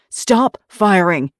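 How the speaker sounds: loud, slow shout; shouted order. Acted. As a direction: shout the order across the deck. Calling out orders.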